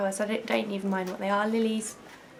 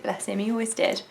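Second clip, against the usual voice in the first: normal talking voice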